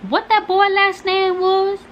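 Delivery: high, slow, drawn-out grandma voice; grandma's pointed question. Retold or imitated. Teller imitating grandma asking the boy's last name, high and slow. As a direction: stretch it out high like a nosy grandma.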